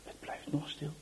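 low, hushed fearful whisper; dread whisper. A narrator's reading. Narrator fearing grandpa is gone, low whisper. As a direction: whisper the worry, barely audible.

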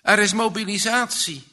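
put-on boy shouting the big news, high and loud; loud, high-pitched, urgent announcement; excited shout. A narrator's reading. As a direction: burst in crying out the news. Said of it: "schreeuwt met overslaande stem".

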